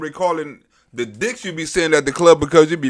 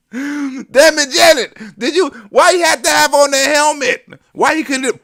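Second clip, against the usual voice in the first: mock scolding; 7 semitones higher than usual.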